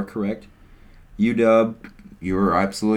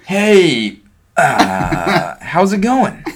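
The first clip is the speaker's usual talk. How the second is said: overeager greeting; 9 semitones higher, 9 dB louder, much slower than usual. Pretend. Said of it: "I was like".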